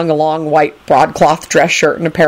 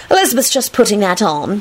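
higher-pitched knowing announcement; a touch of smugness, pitched up; 4 semitones higher than usual. A narrator's reading. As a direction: announce it like a little know-it-all. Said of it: "she announced knowingly".

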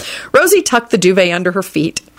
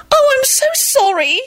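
very high horrified sympathy. A narrator's reading. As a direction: gush out shocked, high-pitched sympathy. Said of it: "she said in a horrified voice".

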